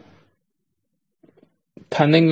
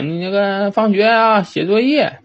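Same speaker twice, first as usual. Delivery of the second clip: lightly imitating an old grandma urging him; grandma nagging; 6 semitones higher than usual. Grandma tells the boy to read after school, higher nagging. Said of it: "我奶说".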